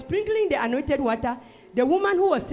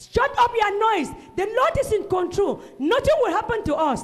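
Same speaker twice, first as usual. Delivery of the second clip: loud, much higher commanding shout; retold shout; 10 semitones higher, 4 dB louder than usual. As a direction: silence the panicking passengers. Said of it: "I stood up and said".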